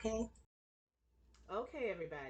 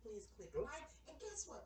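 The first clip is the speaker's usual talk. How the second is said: ordinary delivery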